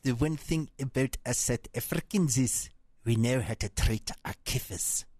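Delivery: put-on thick South African accent, clipped vowels. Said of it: "That was a South African accent".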